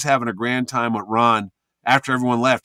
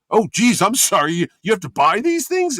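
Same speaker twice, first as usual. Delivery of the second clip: higher-pitched, startled apology; flustered apology; 6 semitones higher, 4 dB louder than usual. Retold or imitated. Actor learns the banner cost money, flustered apology. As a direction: blurt it out, suddenly sorry.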